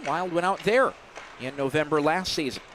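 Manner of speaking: normal talking voice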